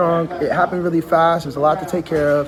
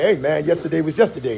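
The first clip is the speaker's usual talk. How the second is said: normal talking voice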